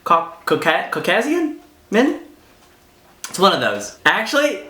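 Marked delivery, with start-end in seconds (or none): 0.0-2.2 incredulous echo. Real, not acted. Teasing echo of own words.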